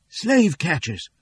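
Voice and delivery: slow, high, urgent warning; crying out. A narrator's reading. Fleeing woman warning the townsfolk, slow high cry. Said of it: "cries Cassie".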